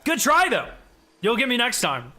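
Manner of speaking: high, loud mock consolation; cocky sing-song gloating; teasing taunt. Real, not acted. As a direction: rub it in playfully. Taunting an opponent after winning.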